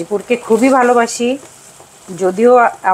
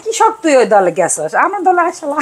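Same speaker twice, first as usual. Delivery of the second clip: Bogura dialect; 6 semitones higher than usual. Retold or imitated. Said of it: "বলে".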